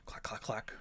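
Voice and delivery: whispered clack noises, no voice. Retold or imitated. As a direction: whisper the clacks like coconut sounds. Imitating coconuts clacking.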